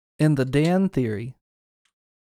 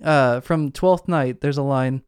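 normal talking voice

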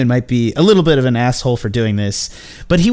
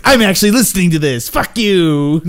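mock-angry yell, raised and breaking into laughter; playful, higher-pitched indignant outburst; exasperated outburst; 5 semitones higher, 4 dB louder than usual. Podcaster imitating the poor man forced to listen, mock outrage.

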